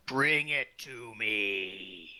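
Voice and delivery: very low, slow and raspy; deep raspy old voice. A narrator's reading. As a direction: growl it out like an ancient lich. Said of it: "a very deep and old and raspy voice say".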